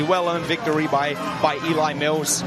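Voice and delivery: ordinary delivery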